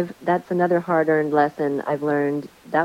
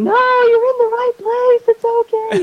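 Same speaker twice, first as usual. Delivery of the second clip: much higher, louder, hurried soothing; high-pitched panicky "it's okay"; frantic reassurance; 8 dB louder than usual. Retold or imitated. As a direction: rush in to calm them down. Rushing to reassure panicked guests.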